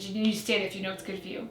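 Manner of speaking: quick, softened deep warning. Retold or imitated. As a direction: repeat the warning low, quiet and fast. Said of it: "it's, like, that deeper voice too".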